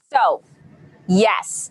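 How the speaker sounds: ordinary delivery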